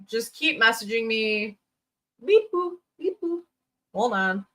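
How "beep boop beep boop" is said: high-pitched robot beeping; mock robot. Streamer joking about her glitchy robot voice on the mic, mock robot. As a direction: playfully beep like a little robot. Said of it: "Man, robot voice".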